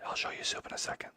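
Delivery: hushed unvoiced whisper. Real, not acted. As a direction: whisper it so the dog stays calm. Whispering aside while dog hides.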